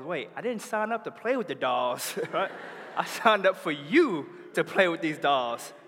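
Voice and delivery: a hint of a playful father pushing back; joking dad. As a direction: play the dad teasing his daughter. Dad voiced, joking protest.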